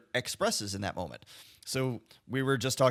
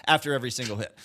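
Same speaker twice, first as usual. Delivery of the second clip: ordinary talk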